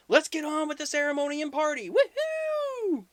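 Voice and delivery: loud, high celebratory cheer; party whoop. Retold or imitated. Ogre kicks off his wedding party, loud high cheering. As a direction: shout it high and end in a whoop.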